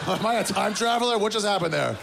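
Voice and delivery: high, mock-astonished waking; dazed amazement. Oversleeper staring at the clock, high mock astonishment. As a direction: act baffled at the clock jumping ahead.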